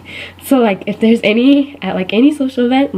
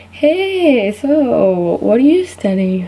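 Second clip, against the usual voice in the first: health freak; 3 semitones higher, much slower than usual. Retold or imitated. Health freak asking what she studies, put-on sing-out greeting. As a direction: imitate an over-friendly health nut. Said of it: "other people that are, like, health freaks".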